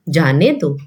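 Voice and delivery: low, falling "जाने दो" set apart; after the comma. Shown as a demonstration. Teacher completes first sentence after the comma, low and falling. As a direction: drop "जाने दो" as a separate closing phrase. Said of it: "अल्प विराम दिया है".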